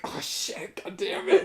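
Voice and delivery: high laughing curse; splashing curse. Retold or imitated. Imitating his friends cursing as they cross the creek. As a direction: curse while slipping into water. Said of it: "Then I hear, like".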